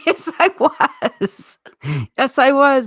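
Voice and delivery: giggling mock baby voice; baby talk. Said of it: "talking like a little baby".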